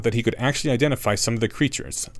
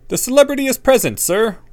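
put-on cheeky boy; 13 semitones higher, 6 dB louder than usual. A narrator's reading. Harry answers cheekily.

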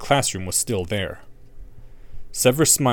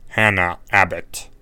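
cold teacher; 4 semitones lower than usual. A narrator's reading. Snape taking roll.

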